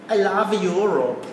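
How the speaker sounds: slow, badly faked German accent on "I love Europe"; a poor attempt at a German accent; a hammed-up German accent, stretched out slowly; mock German accent. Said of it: "I'm trying to do a German accent".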